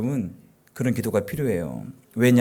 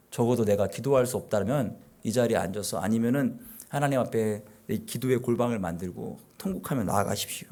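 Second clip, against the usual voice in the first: normal talking voice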